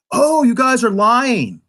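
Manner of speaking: imitated comic relief character. Reviewer mimics Elnor's comedic relief moment, mock comic outburst. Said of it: "comedic relief moment where he's like".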